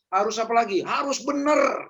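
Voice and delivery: ordinary talk, nothing marked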